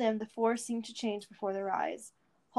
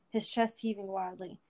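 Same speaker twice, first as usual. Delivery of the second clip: usual speaking voice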